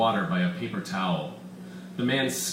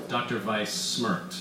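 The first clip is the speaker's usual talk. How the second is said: ordinary delivery, nothing marked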